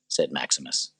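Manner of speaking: ordinary delivery, nothing marked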